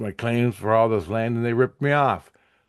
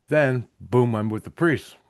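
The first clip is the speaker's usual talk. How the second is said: normal talking voice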